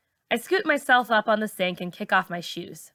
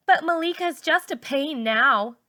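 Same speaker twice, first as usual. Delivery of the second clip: Rays' complaint; 6 semitones higher than usual. A narrator's reading. Rays complaining.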